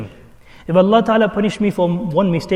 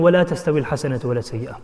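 quick recited Arabic verse, fast; rapid Quranic recitation; 5 semitones lower, much faster than usual. Acted. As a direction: recite the verse in one flowing breath.

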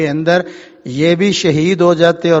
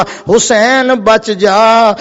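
very slow, higher chant-like warning; long, raised, acted call; drawn-out warning call; 4 semitones higher, much slower than usual. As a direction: stretch each word of the warning. Warning repeated, more drawn out.

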